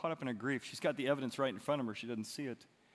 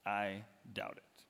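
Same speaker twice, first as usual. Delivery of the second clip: skeptical Thomas; 4 semitones lower, slower than usual. Acted. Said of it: "And he says".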